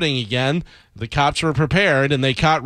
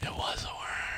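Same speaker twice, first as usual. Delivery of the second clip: hushed dramatic whisper; 17 dB quieter than usual. Acted. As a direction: whisper it like a creepy secret. Gross-out reveal of the worm.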